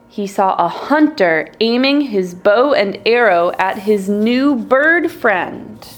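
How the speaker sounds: usual speaking voice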